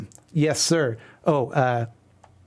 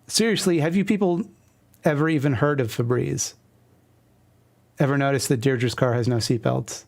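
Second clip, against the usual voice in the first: plain reading voice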